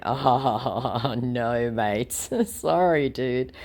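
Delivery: chuckling through the words; mock laughing apology. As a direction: laugh off the scammer lightly. Acts out laughing reply to scammer.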